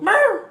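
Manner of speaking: very high, loud yelp of another dog; imitated dog bark. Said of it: "you'll hear another dog go".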